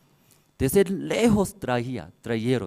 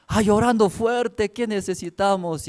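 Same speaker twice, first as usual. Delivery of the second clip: worried teachers; 7 semitones higher than usual. Retold or imitated. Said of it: "Entonces venían maestros".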